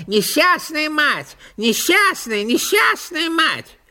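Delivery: high wailing magpie chatter. A narrator's reading. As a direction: wail it like a fussy magpie. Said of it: "без умолку трещала".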